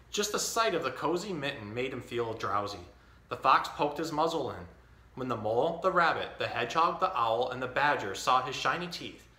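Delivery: put-on reading a picture book to a baby; story reading. Dad reading The Mitten to his baby, storytelling. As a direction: read it like story time.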